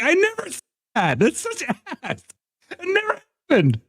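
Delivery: high, squeaky mock denial; mock protest. Streamer protests a guild meme.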